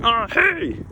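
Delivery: high, breezy phone greeting; Lars answering. Retold or imitated. Lars picking up Magnus's call, a high casual hello. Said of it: "And he was like".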